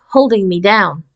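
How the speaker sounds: ordinary delivery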